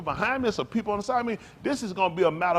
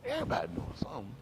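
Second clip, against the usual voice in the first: coaxing friend; 6 semitones higher, 11 dB quieter than usual. Retold or imitated. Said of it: "you say".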